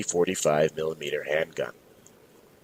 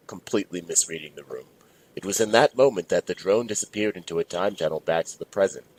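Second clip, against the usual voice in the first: ordinary delivery, nothing marked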